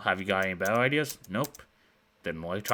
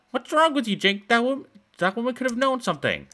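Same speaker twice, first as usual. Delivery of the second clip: put-on exasperated girl; 7 dB louder than usual. Girl scolds Jake outside.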